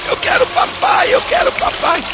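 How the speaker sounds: put-on very high tearful little girl wailing; crying little girl. A narrator's reading. A little girl crying for her father in the flood. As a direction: whimper for daddy like a frightened small child. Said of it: "a pequenininha começou a chorar".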